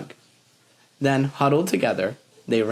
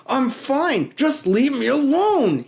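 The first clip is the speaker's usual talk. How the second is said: strained voice; 12 semitones higher, 3 dB louder than usual. A narrator's reading. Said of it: "he said in a strained voice".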